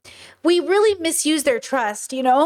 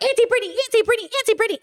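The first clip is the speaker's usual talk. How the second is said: put-on very high, squealing kid voices; excited kids; 11 semitones higher, much faster than usual. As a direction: squeal it like thrilled little kids. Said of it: "And the kids go".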